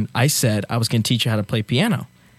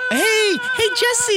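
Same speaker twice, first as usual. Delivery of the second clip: put-on baby wail; much slower than usual. Dad voices wailing baby.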